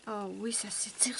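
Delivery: voiceless pleased whisper. Real, not acted. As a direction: whisper your satisfaction.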